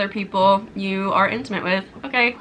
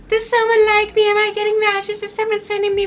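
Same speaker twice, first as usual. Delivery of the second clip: anxious dater; 11 semitones higher than usual. Retold or imitated. Mocking her own needy dating-app thoughts, high and anxious. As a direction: voice the needy swiping self, high. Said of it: "start being like".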